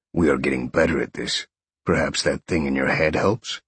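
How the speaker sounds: low-pitched inner lion; lion speaking in a low register; lion's inner voice. A narrator's reading. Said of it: "was his lion's response".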